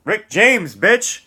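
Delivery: loud, high mock shout of the famous line; hammed-up celebrity yell. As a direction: bellow it like the comedy sketch. Shouted famous comedy catchphrase.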